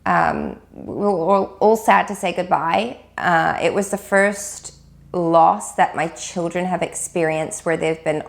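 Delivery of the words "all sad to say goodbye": usual speaking voice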